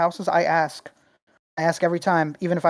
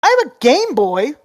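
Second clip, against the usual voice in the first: put-on high, louder old lady voice; tiny old lady; 8 semitones higher, 7 dB louder than usual. Old woman at her garage sale offering a Game Boy, high old-lady voice. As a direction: pipe up like a tiny old grandma. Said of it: "And she goes".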